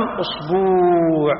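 أصبوع as usbuu', very long u after the b; added waw. Shown as a demonstration. Teacher gives the tenth variant, أصبوع with a stretched uu. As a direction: draw out the waw in أصبوع slowly. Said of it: "بزيادة الواو بعد الباء".

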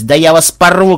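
loud, rising threat; worked-up threatening outburst; angry threat. A narrator's reading. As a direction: bluster loudly at the rivals. Enemy threatens the team.

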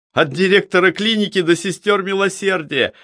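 high-pitched, vigorous exclamation; energetic exclamation. A narrator's reading. Chemist insists to the investigator he knew every staff member, energetic exclamation. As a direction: exclaim it briskly, voice well up. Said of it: "воскликнул энергично Цветов".

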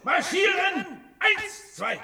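high, shouted marching count; drill count. Acted. Leader drilling his followers in marching, shouting. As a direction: bark the beat like a drill sergeant.

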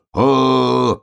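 long, high, loud yell; bear cub's wail. A narrator's reading. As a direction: holler out one held cry. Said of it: "снова завопил медвежонок".

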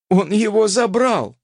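high outraged cry, falling at the end; alarmed cry. A narrator's reading. Repeats it in alarm. (громко, с возмущением)

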